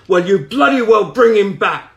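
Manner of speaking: high, loud angry shout. A narrator's reading. The priest shouts at the medium, loud and high. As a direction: demand it back at full voice. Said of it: "He shouted out in the room".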